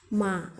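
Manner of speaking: "ma" sagging low in a drawn-out dip; dipping third tone. Shown as a demonstration. Teacher showing beginners the third Mandarin tone, dipping "ma". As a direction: let "ma" sink into a hollow. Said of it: "agak menurun, seperti legokan".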